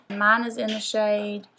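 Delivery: ordinary delivery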